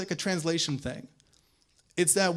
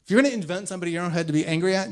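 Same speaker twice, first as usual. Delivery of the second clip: ordinary talk, nothing marked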